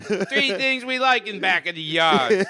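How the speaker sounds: high, loud, slow hammed-up Chicago accent; exaggerated Chicago accent, high and loud; terrible Chicago accent. Shown as a demonstration. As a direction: go big with the bad Chicago impression. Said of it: "This is a terrible Chicago accent".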